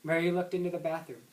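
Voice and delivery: plain reading voice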